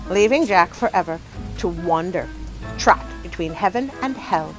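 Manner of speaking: ordinary delivery, nothing marked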